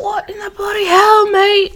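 high-pitched imitation of a British teen; bad British accent. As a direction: put on a squeaky British gamer voice. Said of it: "I do a really bad British accent".

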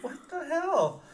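retelling her own bewilderment; baffled. Retold or imitated. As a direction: let it hang, bewildered. Retold confusion at the encounter.

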